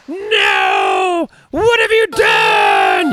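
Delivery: high, slow mock scream of horror. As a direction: shout it like the panicked crowd. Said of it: "hear everyone screaming".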